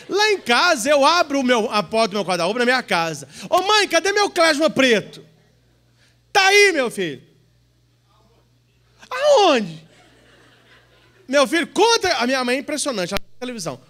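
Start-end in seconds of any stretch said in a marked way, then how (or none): 3.5-12.2 loud, high back-and-forth; comic mother and son. Acted. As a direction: play a son who can't find his shirt and his mother.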